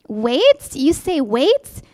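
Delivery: rising, drawn-out disbelief; slow, incredulous echo of the word wait; the poem's speaker balks at God's answer, indignant. Acted. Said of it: "My indignant reply".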